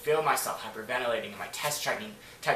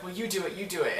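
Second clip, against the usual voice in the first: higher-pitched mock goading; pestering friends; 6 semitones higher than usual. Friends push her into the scary game, pestering. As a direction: imitate friends egging someone on.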